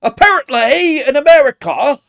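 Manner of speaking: shrieked high British parody; mocking British accent. Parroting the British man in the clip, mock British shout. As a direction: echo it loudly in exaggerated British.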